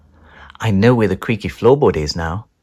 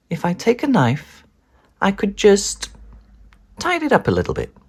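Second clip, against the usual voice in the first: much higher, sly and tempted; sneaky idea; 11 semitones higher than usual. Acted. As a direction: talk yourself into it.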